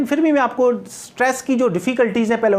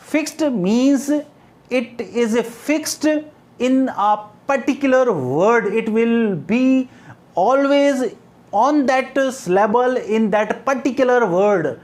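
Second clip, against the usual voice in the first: slow dictation; 3 semitones higher, much slower than usual. Shown as a demonstration. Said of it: "dictating a definition".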